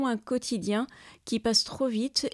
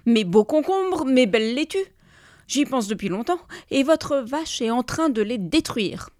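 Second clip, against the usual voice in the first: loud, higher indignant lament; mock-outraged yelling; 4 semitones higher, 6 dB louder than usual. A narrator's reading. Neighbour mourns his imaginary garden to the husband, outraged yelling. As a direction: protest loudly over precious vegetables. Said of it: "hurla le voisin".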